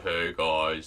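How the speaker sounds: mock-deep, low teenage voice; really low voice. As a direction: imitate a boy forcing his voice down low. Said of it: "talk really low".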